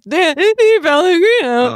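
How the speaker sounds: high, loud replay of the big intro; mock announcer shout. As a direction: belt the name like a game show host. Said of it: "I was like".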